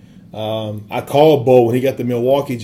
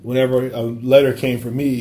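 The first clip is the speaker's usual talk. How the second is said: usual speaking voice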